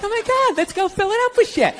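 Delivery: put-on a girlfriend spotting a free day, giddy; mock excited girlfriend. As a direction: gush with fake excitement. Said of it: "They're like".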